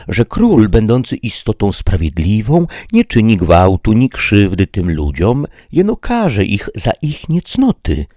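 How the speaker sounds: lightly voiced servant arguing; Sancho objecting. A narrator's reading. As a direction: reason with the master humbly. Sancho argues with the knight.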